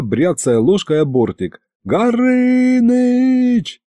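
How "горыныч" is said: high, long-drawn call for help; panicked yell. A narrator's reading. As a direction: holler the name in panic. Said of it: "в панике заорал я".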